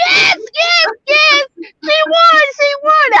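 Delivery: high, loud, teary yelling of yes; yes, yes, yes, she won, yelled high and loud; excited shout. Retold or imitated. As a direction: scream the good news with joy. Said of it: "I was so excited".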